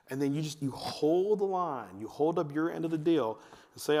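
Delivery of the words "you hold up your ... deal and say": ordinary talk, nothing marked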